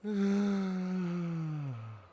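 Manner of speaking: long, high, falling exasperated sigh; long frustrated exhale. Real, not acted. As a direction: let out a drawn-out frustrated sigh. Frustrated at guests refusing ride.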